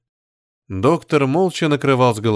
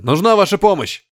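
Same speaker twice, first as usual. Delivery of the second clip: urgent call; 7 semitones higher than usual. A narrator's reading. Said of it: "крикнул Шмель".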